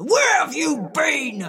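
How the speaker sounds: high, loud furious demand; angry scream. Acted. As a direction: scream it at her in a rage. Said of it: "Rolla screamed at her".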